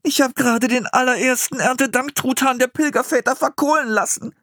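put-on high-pitched, distraught boy; despairing. A narrator's reading. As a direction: lament the disaster in a high voice. Boy despairing over burnt turkey.

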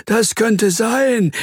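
slow, high musing; high, slow worm voice, mulling it over; pondering. Acted. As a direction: weigh the idea aloud, drawn out. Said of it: "nickt grübelnd".

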